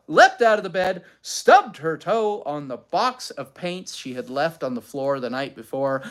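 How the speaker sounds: ordinary delivery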